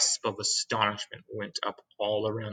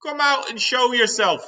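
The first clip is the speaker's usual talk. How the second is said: calling out; 14 semitones higher, 3 dB louder than usual. Acted. Police chief calls up at peach.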